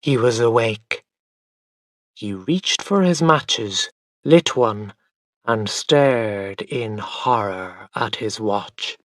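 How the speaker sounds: ordinary delivery, nothing marked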